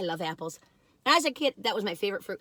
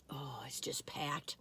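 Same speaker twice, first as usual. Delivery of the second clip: hushed whisper of delight; 8 semitones lower, 16 dB quieter, slower than usual. Real, not acted. Food reviewer whispering over a packed cookie. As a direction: whisper it like a secret treat.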